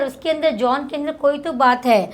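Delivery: ordinary talk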